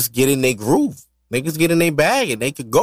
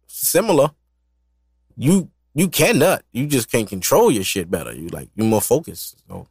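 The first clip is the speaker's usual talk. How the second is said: ordinary talk, nothing marked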